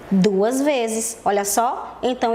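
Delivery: ordinary talk, nothing marked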